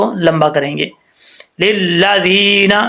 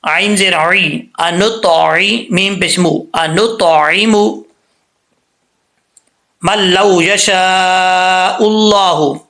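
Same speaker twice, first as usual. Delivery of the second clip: slow melodic tajweed chant; Quran recitation; slower than usual. Shown as a demonstration. Teacher recites the verse for students to repeat, chanted. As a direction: intone each word, long and measured. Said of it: "कैसे कलकला करके पढ़ना है".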